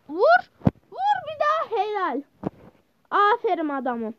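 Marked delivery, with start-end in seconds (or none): 0.1-1.8 high, loud urging; urging shout. Real, not acted. Gamer urging teammate to shoot again, shouting. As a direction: push the teammate to hit.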